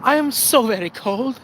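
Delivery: high, meek begging voice; pleading worker. Acted. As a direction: beg the boss, hammed-up and pitiful. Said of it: "going up to our boss going".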